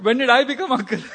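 high, loud question through laughter; amused, incredulous squeal; laughing disbelief. Retold or imitated. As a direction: protest in mock shock while cracking up. Mock shock, laughing at himself.

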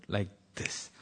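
low, very soft echo; softly repeated for effect; hushed quote. Retold or imitated. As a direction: drop right down, almost under the breath.

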